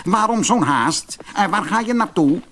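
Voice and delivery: quick, high, friendly dwarf; a curious little dwarf teasing; cheerful dwarf. Acted.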